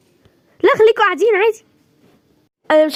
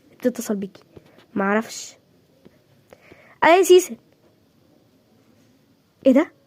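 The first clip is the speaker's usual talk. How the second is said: ordinary delivery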